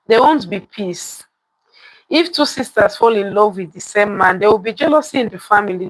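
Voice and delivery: plain reading voice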